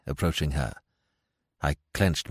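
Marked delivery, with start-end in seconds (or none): none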